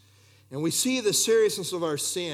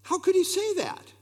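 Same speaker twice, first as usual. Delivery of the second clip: incredulous question; 13 semitones higher than usual. Acted. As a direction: ask it as if you can't believe it.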